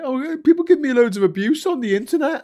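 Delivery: high, loud whiny mock voice of the author complaining; mocking imitation. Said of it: "And then she complains about it".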